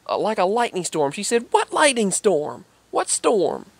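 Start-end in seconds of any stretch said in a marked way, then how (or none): 1.5-3.7 incredulous cashier. Retold or imitated. Cashier's disbelief retold.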